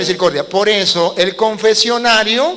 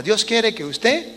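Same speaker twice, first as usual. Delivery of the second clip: ordinary talk, nothing marked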